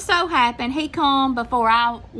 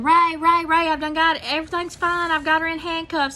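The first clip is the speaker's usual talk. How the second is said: retold scream; 7 semitones higher than usual. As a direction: yell for Ray to stop, all under control. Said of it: "And I'm screaming".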